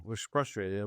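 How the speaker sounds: ordinary talk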